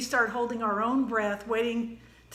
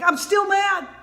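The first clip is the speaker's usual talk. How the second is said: high, loud imitated child shout; child still angry; 9 semitones higher, 9 dB louder than usual. Niece insisting to her daddy, loud mock shout. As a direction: yell it like a stubborn kid mid-tantrum. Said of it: "she was like".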